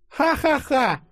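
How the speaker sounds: very high mock laugh; spoken ha-ha-ha, unamused and loud; sarcastic snort. A narrator's reading. Yulia snorting at the hero's joke, sarcastic fake laugh. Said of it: "фыркнула Юля".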